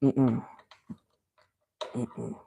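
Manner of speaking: ordinary talk, nothing marked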